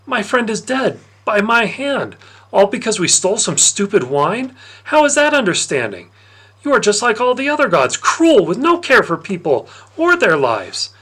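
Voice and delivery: high, loud, tearful accusation; tearful anger. Acted. Patroclus accusing Dionysus over his dead friend, tearful and loud. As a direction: rage at the god through tears. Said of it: "Tears were streaming down his cheeks; only that he was angry".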